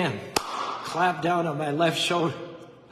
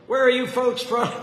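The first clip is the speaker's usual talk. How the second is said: louder, higher friendly drawl; 8 semitones higher, 8 dB louder than usual. Retold or imitated. Stranger greeting the visiting couple, loud friendly drawl. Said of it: "he says in a friendly drawl".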